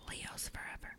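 breathy joking whisper; soft whispered aside. Real, not acted.

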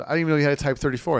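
joking. Retold or imitated. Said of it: "jokingly said".

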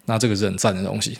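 ordinary talk

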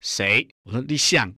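mildly annoyed phone answer; retold irritation. Answering the friend who kept phoning, mildly irritated. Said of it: "我很生气跟他说".

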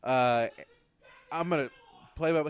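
ordinary talk

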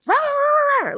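high mock barking rawr; playful dog bark imitation; imitated dog bark. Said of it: "barking like that".

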